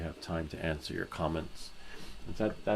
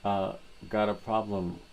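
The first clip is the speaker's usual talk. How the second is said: lightly voiced New Yorker, louder; tough-guy New York growl, half joking; New York accent; 3 semitones higher, 5 dB louder, slower than usual. Voicing the viewer Brian as a mock New Yorker.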